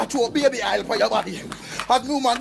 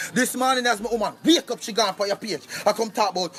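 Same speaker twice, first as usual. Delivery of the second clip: normal talking voice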